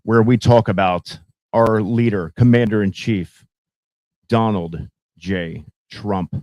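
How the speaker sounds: loud, slow, grand segment intro; show announcer. Acted. Host welcoming internet friends to the Trump segment, loud announcer delivery. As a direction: announce the leader with booming ceremony.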